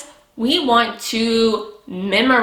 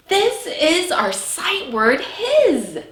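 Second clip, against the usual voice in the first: slow, higher teaching voice on his; teacher modeling; 6 semitones higher, slower than usual. Shown as a demonstration. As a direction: lead the class through his slowly. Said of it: "Can you say that with me".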